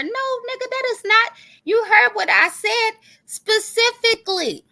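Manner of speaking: high, exasperated protest; heated retort. Real, not acted. Rebutting the rival vlogger, high and exasperated. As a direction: shout him down in disbelief.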